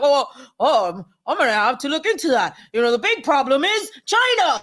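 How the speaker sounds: mock flustered politician, stalling then climbing in pitch; Lindsey Graham. Mocking a senator dodging a question on the bill, hammed-up imitation. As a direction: hem and haw, then pitch up into an exaggerated deflection. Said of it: "He's like".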